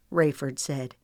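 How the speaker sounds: usual speaking voice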